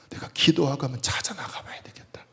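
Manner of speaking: softly whispered inner vow; hushed, determined whisper; whispered resolve. Retold or imitated. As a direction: whisper a quiet resolution to yourself.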